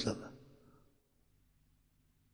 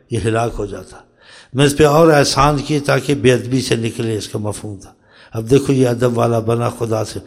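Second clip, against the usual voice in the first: ordinary talk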